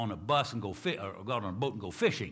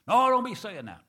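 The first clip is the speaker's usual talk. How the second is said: higher, louder pleading cry; pleading protest; 10 semitones higher, 9 dB louder than usual. Retold or imitated. Congregation begging their pastor not to leave, high pleading. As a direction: beg him not to say it.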